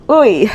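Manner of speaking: loud exasperated oy; emotional groan. Real, not acted. Vlogger catching herself getting emotional, loud groan. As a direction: let out a loud fed-up groan. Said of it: "Now I'm getting emotional again".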